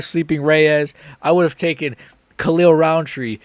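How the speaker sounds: normal talking voice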